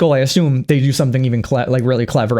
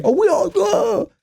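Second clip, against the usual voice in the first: mock Dorothy; slower than usual. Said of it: "Dorothy shows up like".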